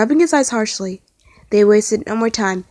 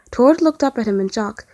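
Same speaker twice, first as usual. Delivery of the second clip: plain reading voice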